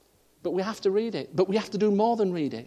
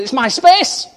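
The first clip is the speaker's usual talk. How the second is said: loud, higher shout of it's my space; retold road-rage shout; angry shout; 10 semitones higher, 13 dB louder, slower than usual. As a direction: yell it out the car at the driver. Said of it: "I said".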